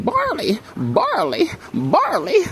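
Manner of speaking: high falsetto moaning barley thrice; mock screaming woman. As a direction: imitate her crying out in a squeaky high voice. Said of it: "she just was screaming".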